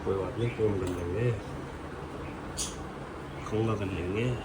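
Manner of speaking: quiet early-morning vlog voice; talking softly. Real, not acted. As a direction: keep it soft, everyone's asleep. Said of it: "इसलिए मैं आराम से बोल रहा हूँ".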